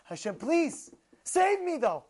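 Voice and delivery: high, urgent acted plea; desperate begging prayer. Sufferer prays to be saved from trouble, acted begging. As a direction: beg loudly, piling up the pleas. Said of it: "You pray".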